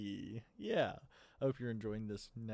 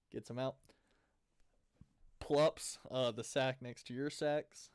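normal talking voice

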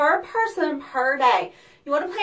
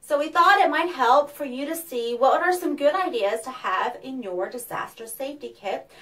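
ordinary delivery

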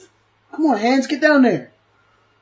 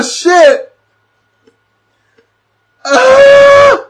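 loud high panicked shouts; real fright, loud and breathless; panicked shouting; 9 semitones higher, 8 dB louder, much slower than usual. Real, not acted. Panicked reactions to killing scene.

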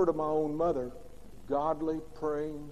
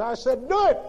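higher, louder imitated bark of a strict mother; barked order; 6 semitones higher, 6 dB louder than usual. Fiery mother ordering her big sons, imitated bark. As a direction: snap the order like a top sergeant. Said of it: "Boy, she barked".

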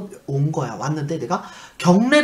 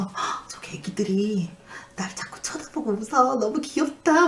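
put-on childlike delight; 5 semitones higher than usual. Patient sees babies in the bed's holes, childlike delight. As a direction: coo in wonder, pitch climbing.

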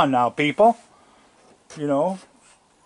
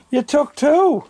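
much higher, playful voice for the animal; high pet talk; 12 semitones higher, slower than usual. Real, not acted. Talking fondly to feeding animals.

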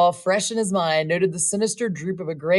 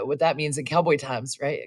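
plain reading voice

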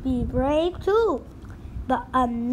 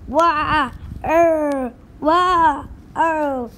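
hammed-up pirate wail; 5 dB louder, much slower than usual. Captain cries.

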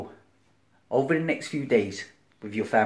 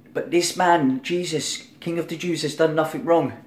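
higher, pleading appeal; Pilate pleading; 6 semitones higher than usual. Acted. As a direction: plead for the innocent man. Voicing Pilate pleading to the crowd.

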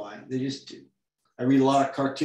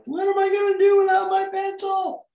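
much higher, louder panicked squeak; panicked squeal; 6 dB louder than usual. Retold or imitated. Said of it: "I'm like".

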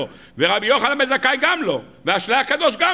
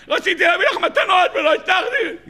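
mock wail; 10 semitones higher than usual. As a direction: wail it like a grown man bawling. Jacob wails to Rachel.

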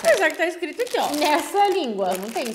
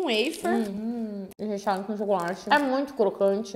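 speaking between bites; chewing while talking; slower than usual. Real, not acted. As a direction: mouth partly full of wafer. Seen 4: Bite happens before; she talks while chewing, wafer held near mouth.

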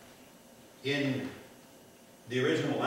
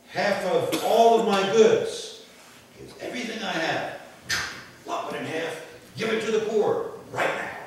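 excited Zacchaeus; 9 semitones higher, 7 dB louder than usual. Acted. Preacher voicing Zacchaeus pledging half his goods, eager. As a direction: pledge it all away with zeal.

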